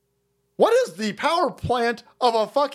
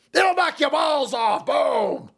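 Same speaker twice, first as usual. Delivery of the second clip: hyped-up endorsement shout; shouted slogan; 5 semitones higher, slower than usual. Shown as a demonstration. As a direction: belt it like an ad slogan.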